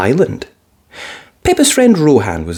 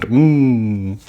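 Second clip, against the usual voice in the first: ordinary delivery, nothing marked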